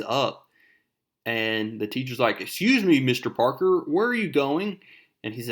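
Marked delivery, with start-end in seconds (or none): 2.4-4.8 raised, higher mock-indignant voice; annoyed teacher. Teacher stopping a student leaving class, mock annoyed. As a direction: call out a student with put-on outrage. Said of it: "the teacher is super pissed".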